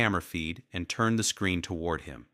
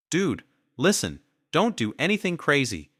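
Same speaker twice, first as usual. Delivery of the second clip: scared begging; 9 semitones higher, 4 dB louder than usual. A narrator's reading. Lover begging at gunpoint.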